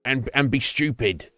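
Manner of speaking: mock insulter. Imitating someone insulting him for being British, mock sneer. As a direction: play a dumb bully hurling lame insults. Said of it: "they're like".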